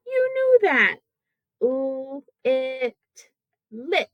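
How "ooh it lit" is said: "Ooh, it" as separate l, i, t, then joined "lit"; level phonics sounds, then the syllable; sounding out, blending. Shown as a demonstration. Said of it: "The first syllable".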